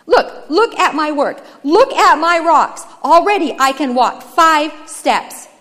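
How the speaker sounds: high, loud, slow emphatic words; forceful, indignant boast; desperate insistence. Acted. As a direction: show off your work to prove yourself. Brother insists his work suffices.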